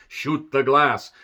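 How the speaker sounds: put-on slow, deliberate accented command; villain's order. Hans orders his men to fire, slow accented command. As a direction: drag out each word like a cold villain.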